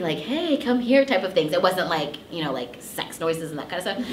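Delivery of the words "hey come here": sexy. Retold or imitated. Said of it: "kinda, like, sexy".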